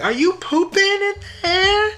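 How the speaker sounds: a nosy high-pitched shout through the door; high voiced Maddie. Maddie quizzing Nate through the bathroom door, squeaky high. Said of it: "She's going down the list like".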